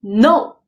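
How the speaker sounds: "No" starting high and loud, dropping; falling tone. Shown as a demonstration. Patient refusing the dentist's price, "No" falling sharply. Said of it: "it's going".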